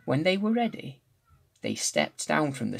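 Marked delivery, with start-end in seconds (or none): none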